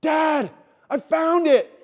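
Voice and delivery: put-on a little boy yelling in triumph that he found it; excited kid shout. Father imitating his son shouting from across the store, laughing. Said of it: "I hear his little voice".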